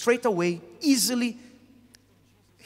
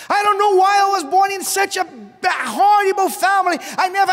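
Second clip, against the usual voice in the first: high, loud cry of despair; loud lament; 11 semitones higher, 7 dB louder than usual. Retold or imitated. Congregant crying out about a horrible family, loud and high. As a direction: wail it out at full force.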